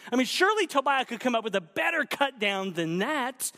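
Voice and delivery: ordinary delivery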